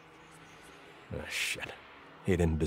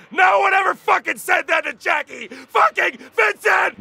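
furious shout. Shown as a demonstration. Detective rants about never being thanked, shouting. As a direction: roar your rage at the injustice. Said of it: "alternate take of same line".

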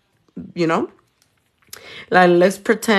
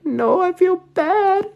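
high whiny voice; 13 semitones higher, slower than usual. Retold or imitated. Quoting her own refusal.

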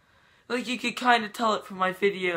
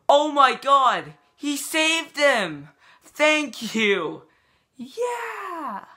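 much higher, louder joyful outburst; excited cheering; 9 semitones higher, 4 dB louder, much slower than usual. Acted. Vlogger cheering the doctor who saved his brother, high excited shouts. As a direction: cheer as if a miracle just happened.

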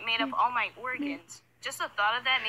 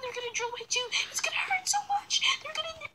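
scared whimpering; 8 dB louder than usual. Retold or imitated. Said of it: "and I was all like".

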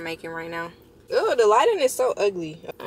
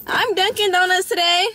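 higher and louder, rising proud claim; playful proud declaration; 10 semitones higher, 5 dB louder than usual. Real, not acted. Vlogger proudly claiming she is Dunkin' today, loud and rising. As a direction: announce it like a proud boast.

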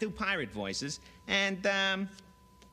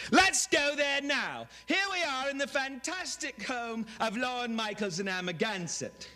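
Robin Leach; 11 semitones higher than usual. Retold or imitated. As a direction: overblown TV host gushing. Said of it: "screaming, you know, guy who screams, this, uh, Robin Leach".

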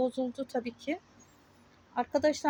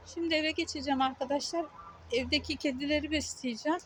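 ordinary talk

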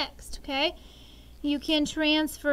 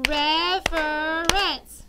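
louder, very slow REF-er-ence in beats; slow syllable count; 4 dB louder, much slower than usual. Shown as a demonstration. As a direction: stretch reference into three claps. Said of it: "Do that one again".

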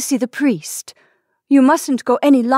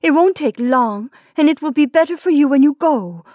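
higher, louder nervous begging, rising toward the end; nervous pleading; 5 semitones higher, 4 dB louder than usual. Acted. Wife begging dying husband to see the priest, nervous and pleading. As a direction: beg him anxiously to accept the priest. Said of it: "she said nervously".

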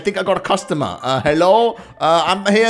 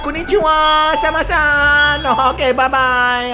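high, loud, slow sing-song shopkeeper; exaggerated bowing-clerk sing-song; mock Japanese shopkeeper; 8 semitones higher, 5 dB louder, slower than usual. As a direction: ham up a cheery Japanese clerk. Voicing Japanese greeting cashier.

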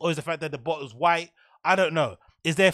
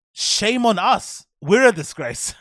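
put-on louder, slow, theatrical lawyer with a weird accent; lawyer impression; 4 semitones higher, 6 dB louder, slower than usual. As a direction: ham up a lawyer's grand mea culpa.

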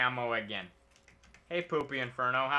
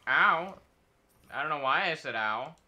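ordinary talk, nothing marked

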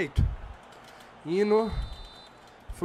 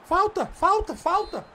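urgent appeal; 7 semitones higher, 3 dB louder, slower than usual. Real, not acted. Appeals for a foul in game.

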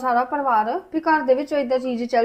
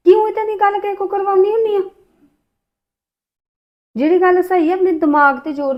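exasperated rebuke; 7 semitones higher, 6 dB louder than usual. Acted. Husband rebukes wife's accusation.

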